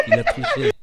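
high-pitched laughing fit; can barely speak for laughing; laughing hysterically. Real, not acted.

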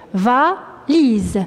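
valise split into va, pause, lise; syllable-by-syllable dictation. Shown as a demonstration. Teacher dictates valise to pupils writing, syllable by syllable. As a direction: break valise into two separate syllables.

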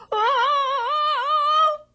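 very high, loud frightened wail; a panicked howl while running; terrified cry. Acted. Man flees in terror.

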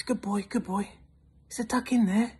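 high-pitched doggy talk. Acted. Hunter asking his dog about the duck, high coaxing. Man talking sweetly to dog.

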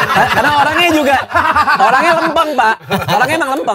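high, loud voice breaking into real laughter; laughing hard. Real, not acted. Comedian cracking up over the unbothered rider, high laughing voice. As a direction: crack up as you talk, pitch shooting up. Cracking up while telling funny story.

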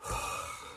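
soft, breath-only sigh; weary huff; exasperated sigh. A narrator's reading. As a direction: let out a fed-up breath. Ron exasperated with both companions.

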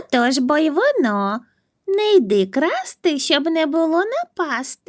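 high character voice. Acted. Cartoon figure giving the story's moral in a high put-on voice.

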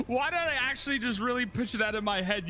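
normal talking voice

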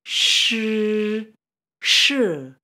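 "Shi" as a buzzing SH, first held long, then short and falling; SH blender sound. Shown as a demonstration. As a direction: draw out "Shi" like a blender with SH in front. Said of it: "just like the blender noise".

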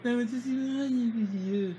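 high, loud mock pleading; exaggerated high-pitched teasing mimicry; mocking imitation. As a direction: imitate a whiny plea, pitched well up. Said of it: "You just call and say".

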